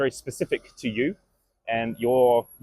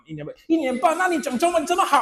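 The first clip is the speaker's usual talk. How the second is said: very high, fast gushing praise; 热情地夸奖; heartfelt enthusiasm. Retold or imitated. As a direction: overflow with delight. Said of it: "just so enthusiastic, so heartfelt".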